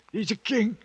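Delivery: cling to the memory; mild manic boasting; feverish pride. Acted. Rambling pride of broken man.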